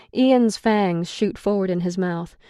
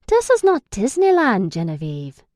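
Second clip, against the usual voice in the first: stern Irish man, raised at first; serious tone; 3 semitones higher, 6 dB louder than usual. A narrator's reading. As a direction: lay it down firmly. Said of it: "Declan says in a serious tone".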